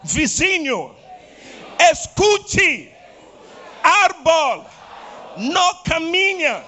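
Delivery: shouted high and loud at the neighbor. Shown as a demonstration. Preacher shouting to the neighbor who doesn't understand, loud. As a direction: belt it out so the neighbor finally hears. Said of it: "Habla más fuerte".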